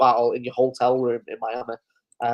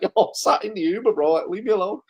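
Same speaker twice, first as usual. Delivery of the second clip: higher-pitched, amused mock protest; lightly exasperated, higher voice; retold protest; 6 semitones higher than usual. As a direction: imitate a friend pleading to be left alone. Retells friend's protest over voice notes.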